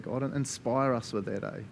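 ordinary talk, nothing marked

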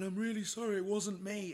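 high, loud pleading; mock-panicked protest; frantic apology. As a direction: plead innocence to an angry partner. Protesting innocence to angry wife.

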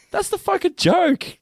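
high, stretched-out exasperation; mock-exasperated comeback; exasperated retort. Retells annoyed retort to episode critics.

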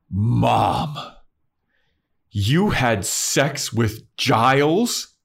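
stunned daughter confronts her mother; shocked Buffy. Retold or imitated. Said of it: "and Buffy goes".

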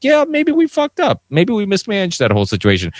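high, hammed-up politician's shrug; mocking a careless politician. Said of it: "they're like".

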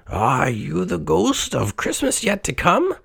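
high-pitched, questioning character voice; Scrooge. A narrator's reading. Old miser questions a hooded phantom, high character voice. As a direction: rise high into a wary question. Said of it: "he questioned".

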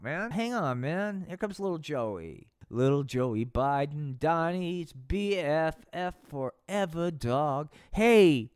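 ordinary talk

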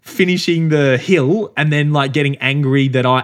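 hammed-up indignation at a podcast story; mock indignant outburst. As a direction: imitate a friend's half-laughing outrage. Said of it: "And he goes".

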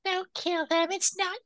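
very high, mock cartoon girl voice; squeaky heroine impression. Podcaster imitating heroine objecting to killing, high cartoon voice. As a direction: plead in an exaggerated high-pitched girly voice. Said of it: "And she's like".

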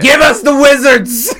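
high, drawn-out comic yell; mock demanding shout. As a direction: bellow it like a greedy giant. Acting out Disney demanding a franchise.

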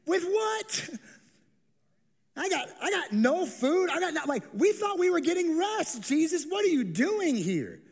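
high, exasperated protest; sarcastic disciples. Acted. Tired disciples balking at Jesus, high sarcastic whine. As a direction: whine it up high, mock-incredulous. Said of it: "respond with the same sarcasm".

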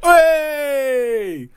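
high, long drawn-out mock cheer; retold cheer. Film fan reliving a surprise reveal, excited cheer. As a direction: re-enact a big cheer, held high.

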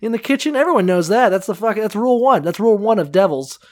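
high-pitched matter-of-fact nanny; know-it-all nanny. Acted. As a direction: explain it as if everyone knew. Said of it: "She's like".

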